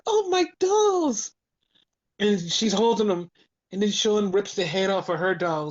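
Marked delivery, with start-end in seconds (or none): 0.0-1.3 much higher, slow, put-on little girl voice; mock childish delight. Tatum receiving the dolls, slow high childish mock delight. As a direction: coo over the dolls like a child.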